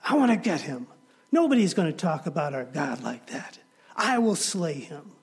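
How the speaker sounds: put-on high, pleading boy; straining to break free. David to his brothers holding him, high and straining. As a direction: fight against the arms holding you back. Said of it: "He said".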